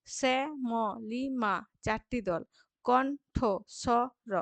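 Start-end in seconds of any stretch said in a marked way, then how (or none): 0.1-1.5 শ্যামলিমা slowly as শ্যা, ম, লি, মা; split into syllables. Shown as a demonstration. Teacher counting syllables, slow and separated. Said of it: "দল বিশ্লেষণ করে". 2.8-4.3 কণ্ঠস্বর very slow, in four spaced beats; split into syllables. Shown as a demonstration. Teacher counting syllables, very slow and broken up. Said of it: "দল বিশ্লেষণ করে".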